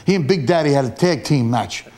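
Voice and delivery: usual speaking voice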